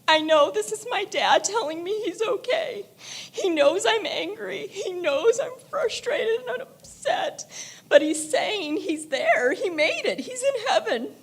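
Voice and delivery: words pushed through real tears, a laugh breaking in near the end; crying. Real, not acted. Grieving daughter tells the stranger the sign is from her dad, crying.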